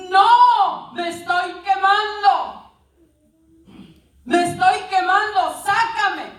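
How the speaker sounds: high, loud cries for rescue; acted screaming plea. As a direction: shout in mock agony as the burning cup. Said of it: "gritaba y decía".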